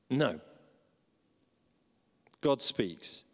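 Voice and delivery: ordinary talk, nothing marked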